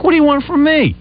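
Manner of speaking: high-pitched exasperated outburst; retold angry exasperation; pissed off. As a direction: throw up a fed-up plea, voice jumping high. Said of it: "I was really pissed".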